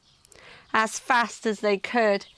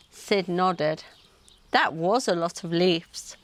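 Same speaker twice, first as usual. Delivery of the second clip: ordinary delivery, nothing marked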